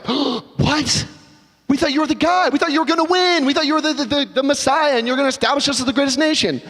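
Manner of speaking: high, stunned protest; surprised disbelief. Acted. Disciples reacting to Jesus' death prediction, shocked and high. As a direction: blurt it out in disbelief. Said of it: "One might be complete surprise".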